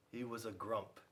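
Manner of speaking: ordinary delivery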